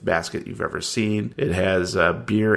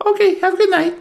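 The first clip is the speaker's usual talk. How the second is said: high chirpy desk clerk; Tracy voice; 6 dB louder, faster than usual. Acted. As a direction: pitch way up for the clerk.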